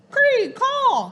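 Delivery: high, loud bird call; raven croak. Acted. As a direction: caw an order to a guard. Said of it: "he croaked to one of his bodyguards".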